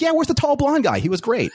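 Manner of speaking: lightly mocked-up eager chiming in; imitating an eager woman. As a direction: pitch it up high as her quote. Voicing Michelle's eager question.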